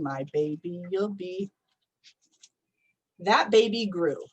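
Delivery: ordinary delivery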